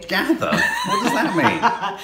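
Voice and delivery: high, slow, laughing disbelief; incredulous squeak. Real, not acted. Baffled laughing protest.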